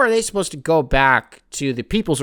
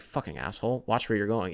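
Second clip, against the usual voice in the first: muttered much softer, under the breath; muttered insult; 5 semitones lower, 7 dB quieter than usual. Retold or imitated. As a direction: grumble it at the stranger. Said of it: "You're like".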